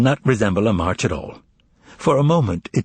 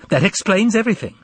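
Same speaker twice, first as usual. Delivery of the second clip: higher and fast, a triumphant exclamation; exclaiming; 9 semitones higher, faster than usual. Acted. Young relative seeing through her uncle, exclaiming high and fast. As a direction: blurt it out higher, like a sudden discovery. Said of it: "she exclaimed".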